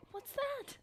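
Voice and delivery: very high-pitched, startled question; startled squeak. Real, not acted. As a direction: squeak it out in alarm. Still startled by the creature.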